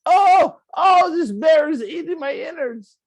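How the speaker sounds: hammed-up terrified shriek; mock bear-attack scream. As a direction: yell as if a bear is mauling you. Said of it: "or you could be like".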